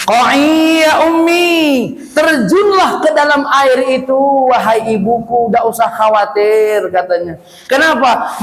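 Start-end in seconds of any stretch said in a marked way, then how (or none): 0.1-6.9 put-on higher, slow, gentle baby voice; a miraculous infant calming its mother; higher, slower voice of a baby reassuring its mother; baby speaking. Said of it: "Bayinya ngomong".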